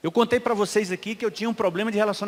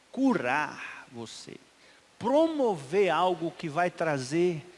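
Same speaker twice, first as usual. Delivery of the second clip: soft, slow, lower appeal to each listener; intimate altar call; 5 semitones lower, 4 dB quieter, slower than usual. Acted. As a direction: draw them in gently, one question at a time. Apelo íntimo à congregação. (apelo suave e lento)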